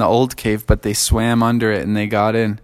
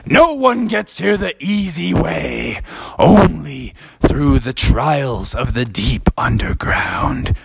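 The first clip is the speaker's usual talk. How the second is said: knight's solemn reply; 3 semitones higher, slower than usual. Acted.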